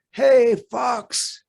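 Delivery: hearty shout to a distant fox; calling out. Acted. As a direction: call out to get the fox's attention.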